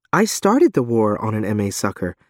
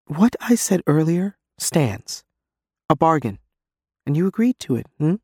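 shaky voice; 7 semitones higher than usual. Acted. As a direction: let the voice tremble. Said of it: "my turn to sound shaky".

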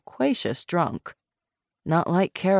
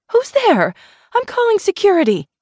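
alarmed shout; 7 dB louder than usual. Acted. Intruder unlocking her door at night.

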